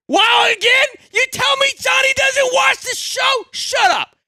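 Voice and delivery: stunned shout. Real, not acted. Surprised by a commitment announcement.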